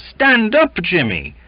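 high, loud urging; heaving cry of command; straining exclamation. A narrator's reading. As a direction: urge him up forcefully. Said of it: "with a heave of the shoulders".

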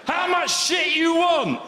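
high, slow, loud mock Chinese shout; hammed-up Chinese accent, shouting. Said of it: "All they wanna know is".

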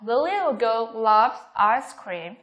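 slow model sentence, "ice cream" falling; falling intonation. Shown as a demonstration. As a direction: bring the pitch down on "ice cream". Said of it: "đều xuống giọng ở cuối câu".

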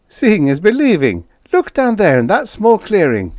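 high, loud spaceman urging a look; excited. Acted. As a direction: call a crewmate to see, thrilled. Spaceman excitedly points out discovery.

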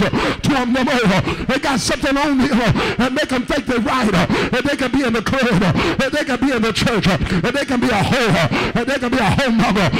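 loud, driving revival cadence with a gasped "uh" between phrases; full-force preaching. Real, not acted. Preacher urging the congregation to cry out to God, full-force preaching. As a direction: preach it hard in short, punched bursts.

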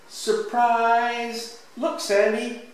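put-on high, lifted announcement to a child; storybook surprise reveal, high and excited; excited surprise call. A narrator's reading.

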